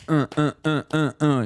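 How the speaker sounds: short repeated mock grunts; imitated rhythmic grunts. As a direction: grunt out a steady crude rhythm. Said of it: "it was mostly just like".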